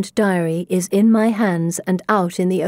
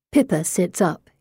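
ordinary delivery

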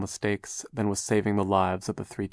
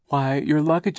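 fussy aunt; 7 semitones higher, 4 dB louder than usual. A narrator's reading. Aunt Josephine speaks.

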